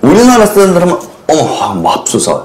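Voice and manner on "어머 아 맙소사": low, slow and soft "어머, 아, 맙소사"; a dull, lowered Korean gasp; flat Korean exclamation. Retold or imitated. Teacher showing how Koreans exclaim, flat. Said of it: "이렇게 말하잖아".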